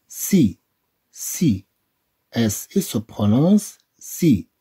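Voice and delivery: slow syllable drill, "ce" said clearly; slow sound drill. Shown as a demonstration. Teacher drilling French syllables with pupils, slow. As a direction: model each syllable slowly.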